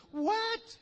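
high, loud incredulous yelp; exaggerated outraged "what"; mock disbelief. Preacher reacts to refusal to forget.

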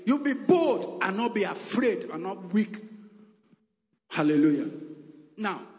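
high, loud, emphatic preacher delivery; forceful preaching. Real, not acted. Preacher urging brethren to claim God's promises, forceful preaching. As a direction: drive each phrase home to the congregation.

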